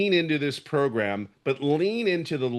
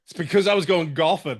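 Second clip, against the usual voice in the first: laughing; 4 semitones higher than usual. Real, not acted. Laughing at blue shirt teasing.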